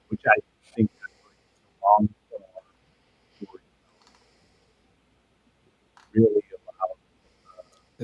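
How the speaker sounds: glitchy, breaking-up audio; robotic mic dropout. Real, not acted. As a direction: voice cutting out like a bad connection. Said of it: "Is he roboting for you guys?".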